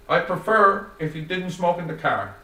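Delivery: polite but firm, keep it even. A narrator's reading. Joey asking the narrator not to smoke, even tone. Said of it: "keeping an even tone".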